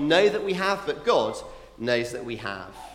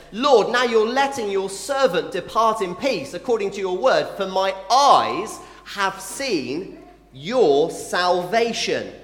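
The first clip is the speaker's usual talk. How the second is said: scripture reading; 3 semitones higher, 4 dB louder, slower than usual. Acted. Simeon's prayer read aloud.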